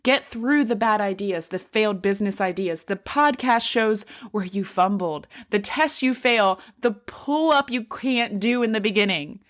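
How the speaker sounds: usual speaking voice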